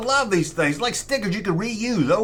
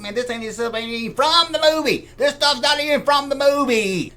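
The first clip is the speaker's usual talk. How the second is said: higher, put-on voice of a grumbling fan; mock viewer complaint; 9 semitones higher than usual. Collector mimics a nitpicking viewer, put-on higher grumbling voice. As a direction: play a nitpicking fan who calls the merch fake. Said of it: "So you gotta tell me".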